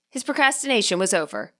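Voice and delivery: ordinary delivery, nothing marked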